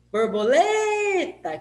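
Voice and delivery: LE in "Borboleta" long, high and loud; "Borboleta" hollered with a held LE; calling out, LE prolonged. Shown as a demonstration. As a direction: call "Borboleta" like a name, stretching the third syllable. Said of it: "Quem que eu falei mais?".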